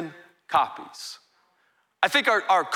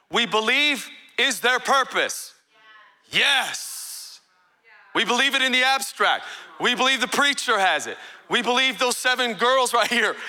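preaching with force; 4 semitones higher than usual. Acted. Preacher challenging the church on belief, forceful.

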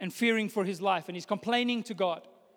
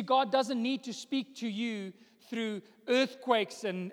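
ordinary talk

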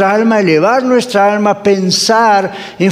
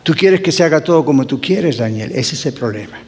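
low stern God voice; 4 semitones lower, 3 dB quieter than usual. Retold or imitated. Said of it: "me dijo".

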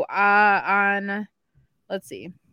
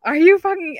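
loud, high angry cry; angry outburst; 8 semitones higher, 9 dB louder than usual. Retold or imitated. Elliptical dies mid-workout, loud angry cry. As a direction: explode at the broken machine.

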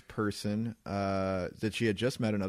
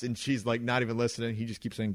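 ordinary talk

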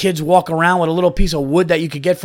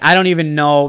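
ordinary delivery

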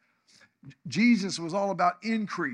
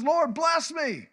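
much higher, louder, slow cry to God; fervent plea; 7 semitones higher, 5 dB louder, slower than usual. Acted. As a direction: call out to the Lord. Jabez voiced praying to God.

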